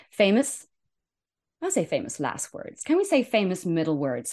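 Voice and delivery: ordinary talk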